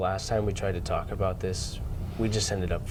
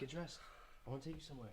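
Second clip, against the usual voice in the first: hushed, gentle wake-up voice; soft and quiet; 2 semitones higher, 23 dB quieter, much faster than usual. Acted. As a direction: coax him awake softly. Softly waking sleeping father.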